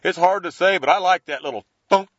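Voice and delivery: ordinary talk, nothing marked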